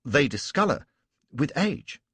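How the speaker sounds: a lightly voiced officer answering firmly; Reynolds replying. A narrator's reading. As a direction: stand your ground politely. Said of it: "said Reynolds".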